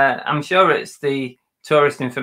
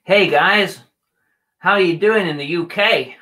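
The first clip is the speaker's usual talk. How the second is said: higher, louder put-on American accent; attempted American accent, pitched up; 5 semitones higher, 4 dB louder than usual. Shown as a demonstration. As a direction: put on a chirpy American greeting. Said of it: "Uh, American accent".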